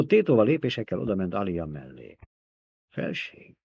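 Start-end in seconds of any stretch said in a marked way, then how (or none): 2.9-3.6 hushed, timid plea; pleading. A narrator's reading. Baron begging the emperor, softly pleading. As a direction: plead softly, barely voiced. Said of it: "mondta könyörögve".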